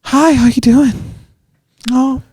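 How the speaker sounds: breathy high pop-star greeting; [name] voice. Retold or imitated. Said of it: "That [name] ass voice".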